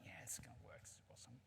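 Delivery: very soft, whispered self-talk; whispered thought. Acted. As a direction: murmur it privately as the monkey's thought. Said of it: "he's thinking".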